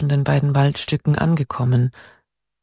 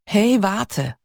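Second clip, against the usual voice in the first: a raised call from behind, higher pitched; 6 semitones higher than usual. A narrator's reading. Voice behind Hannah calling her to wait, raised call. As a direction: call after her to stop. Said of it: "rief eine Stimme hinter ihr".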